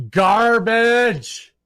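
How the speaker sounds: sudden full-throated yell of garbage; shout. Real, not acted. Streamer shouting his verdict to chat, full yell. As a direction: bellow the word out at the top of your lungs.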